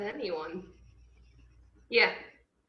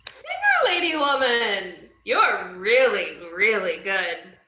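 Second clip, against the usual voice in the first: put-on drunk gushing; 9 dB louder than usual. Drunk Harley gushing loudly at Canary the singer. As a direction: gush at her way too loudly.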